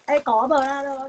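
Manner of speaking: loud, slow call across the room. Real, not acted. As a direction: call out to someone away from the mic. Calling out to helper.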